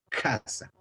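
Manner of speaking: "Casa." with a breathy, aspirated K. Shown as a demonstration. Teacher repeating the aspirated highland "Casa.". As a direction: push extra air through the K of "Casa.". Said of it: "como que la respiras más".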